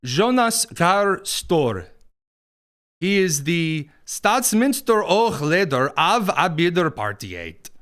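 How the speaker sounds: slow, exaggerated Norwegian accent; mock Norwegian accent. Host naming Norway's prime minister and his title, mock Norwegian accent. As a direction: draw out a badly faked Norwegian accent. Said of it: "I have no idea what a Norwegian accent is".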